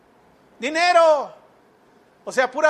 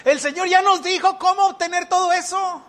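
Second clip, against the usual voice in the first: mock sudden recollection; 5 semitones higher than usual. Preacher plays a listener whose memory is refreshed, mock realization. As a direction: act as if it just dawned on you. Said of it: "ya se le refrescó la memoria".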